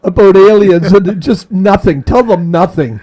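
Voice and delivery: loud, laughing plan; loud joking. Real, not acted. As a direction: joke it out loud. Joking plan, much louder.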